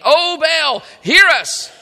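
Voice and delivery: crying out to Baal. Acted.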